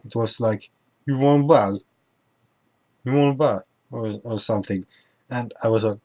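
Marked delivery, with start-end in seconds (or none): 1.1-3.6 higher, louder mock Scottish with dropped consonants; slurred Scottish accent. Shop staff asking about a bag, imitated slurred Scottish accent. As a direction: imitate Scottish mumbling, swallowing word endings. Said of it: "don't pronounce all the letter".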